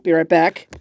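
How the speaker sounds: usual speaking voice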